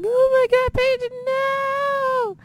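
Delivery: high, loud tearful cry of "no". Retold or imitated. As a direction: wail it out as if heartbroken. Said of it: "I'm just like".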